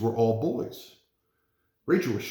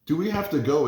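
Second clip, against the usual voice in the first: put-on slightly wobbling girl's voice; 5 semitones higher, 5 dB louder than usual. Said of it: "trying to keep her voice from wobbling".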